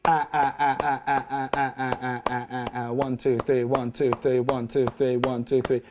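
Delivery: counting triplets softly in time; counting the beat. Shown as a demonstration. Guitar teacher counting the triplet rhythm to students, softly. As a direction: count it out one two three under the clapping. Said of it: "if I just clap my hands".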